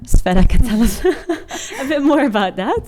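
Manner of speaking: says it through a light laugh; amused, laughing handover. Real, not acted. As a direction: giggle while passing her the word. Handing over to colleague, laughing.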